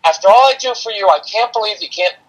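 mock exasperated parent, high and loud; barking at kids. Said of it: "you're barking little things".

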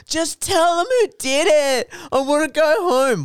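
put-on high, loud whiny kid's plea; mock crying. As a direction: wail it slowly like a kid imitated in tears. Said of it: "One kid started crying".

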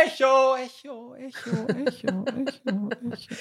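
high calls that die away; fading echo. Shown as a demonstration. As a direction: imitate an echo fading into the distance. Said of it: "jetzt zeig uns mal den Hall".

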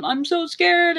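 high, lightly mock-weepy plea; a touch of whimper, acted; retold tearful fear. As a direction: imitate a scared mother crying. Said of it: "You were crying and weeping".

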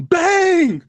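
booming announcer call, pitched high; loud sports announcer. Retold or imitated. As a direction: shout it like a play-by-play call. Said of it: "sports announcers that be, like, mad loud".

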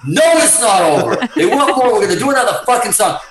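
high, loud, laughing insistence; insistent laughing protest. Retold or imitated. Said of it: "And I'm like".

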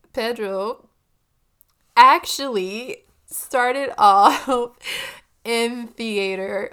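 laughing, stretched-out retry; laughing through it. Real, not acted. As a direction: fight off giggles, drawing it out slowly. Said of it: "Third time's the charm".

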